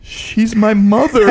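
embarrassed son protesting to the comic; mortified son. Retold or imitated. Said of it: "And I'm like".

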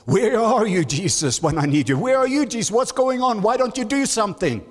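high, pleading cry of frightened disciples; desperate cry to Jesus. Retold or imitated. Preacher voicing disciples crying out to Jesus, high and pleading. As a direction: plead upward like someone lost in the storm.

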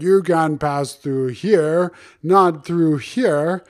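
very slow, drawn-out alligator threat; slow, menacing cartoon alligator; angry alligator. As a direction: block their way as a storybook alligator, each word stretched. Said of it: "the big angry alligator".

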